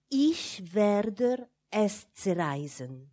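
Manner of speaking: slow German words with hissed consonants; hissing German threat. A narrator's reading. Child secretly threatening the silk, slow hissing German. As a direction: let zerreißen hiss, drawn out slowly. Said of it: "Le mot zerreißen rend un son sifflant, féroce".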